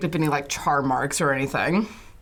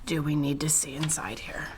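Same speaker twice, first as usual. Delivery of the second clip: hushed, voiceless whisper; 2 semitones lower, 7 dB quieter than usual. Acted. Player asks partner about looking inside, whispering.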